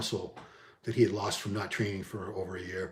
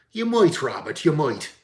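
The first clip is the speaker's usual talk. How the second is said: quick cartoon-cop Irish accent, first word much higher and louder; 5 semitones higher, 6 dB louder than usual. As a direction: toss off a comic Irish cop line. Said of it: "you wanna hear my Irish accent".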